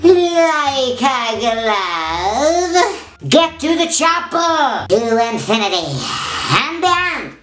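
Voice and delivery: squeaky voice after sucking helium; chipmunk-like helium squeak. Real, not acted. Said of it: "as my voice gets squeaky".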